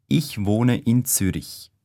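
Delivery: usual speaking voice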